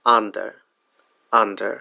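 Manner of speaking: under with a short open first vowel; example word demo. Shown as a demonstration. Teacher modelling the word under. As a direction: say under slowly and clearly. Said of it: "আরেকটা example".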